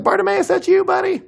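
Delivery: very high, loud mock greeting; playful high-pitched shout; excited recognition. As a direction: call out to a buddy you recognize. Early church recognizing a friend.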